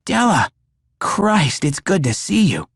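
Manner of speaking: high, loud joyful relief; relieved. A narrator's reading. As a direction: greet her with relief. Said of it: "with quite a bit of relief".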